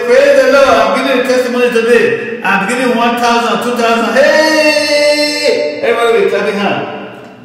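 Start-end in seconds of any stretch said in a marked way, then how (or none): 0.0-4.1 loud, high showy proclaiming; boastful rich donor. Retold or imitated. Rich brother announcing his big offering to the congregation, loud and showy. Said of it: "This brother will say". 4.2-5.8 loud high whoop of joy; excited cheer. Retold or imitated. Congregation cheering the rich donor's offering, loud whoop.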